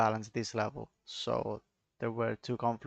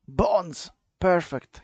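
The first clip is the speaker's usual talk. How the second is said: louder, higher mock delight; sarcastic cheer; 6 semitones higher, 7 dB louder, much slower than usual. Real, not acted. Streamer mocking the bond chart, sarcastic cheer. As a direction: fake enthusiasm over useless bonds.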